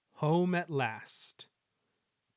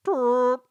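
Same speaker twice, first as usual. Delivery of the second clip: answering horn; 11 semitones higher, 11 dB louder than usual. A narrator's reading.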